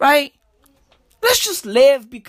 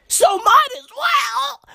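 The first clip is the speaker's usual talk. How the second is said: shout. Real, not acted. Ranting about case numbers.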